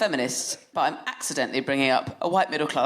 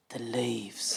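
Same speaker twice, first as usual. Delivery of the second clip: put-on low, much softer childlike answer after thinking; toddler son; 7 semitones lower, 10 dB quieter than usual. Toddler son answering mum's question, soft considered mumble. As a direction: mumble it like a pondering little boy. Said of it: "thought about it for ages and then went".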